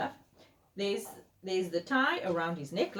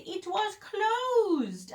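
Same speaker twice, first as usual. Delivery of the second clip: high, drawn-out, woeful reveal; dramatic storytelling; 14 semitones higher, 3 dB louder, much slower than usual. A narrator's reading. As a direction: stretch out the awful news. Dramatic bad news in story.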